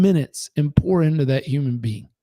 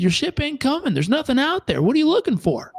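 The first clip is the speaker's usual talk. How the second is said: put-on lifted, higher voice of the old man, a touch wise; voicing the old beach bum; 10 semitones higher than usual. Homeless old man to ruined tycoon, higher voiced. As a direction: play the old stranger, pitched up.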